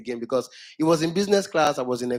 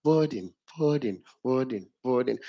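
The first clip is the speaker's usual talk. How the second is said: boarding fading softer each time; tannoy echo dying out; echo again; 3 semitones lower, 4 dB quieter than usual. Retold or imitated. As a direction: repeat the echo trailing off. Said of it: "the voice comes with echo".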